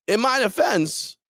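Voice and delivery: giggling through the words, high and slow; laughing. Real, not acted. Host laughing off being hungover, laughing. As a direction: laugh it out while pleading your case.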